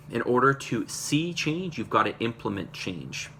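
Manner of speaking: "In order to see change" drawn out slowly; slow emphatic repeat. Real, not acted. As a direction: repeat the motto deliberately, word by word.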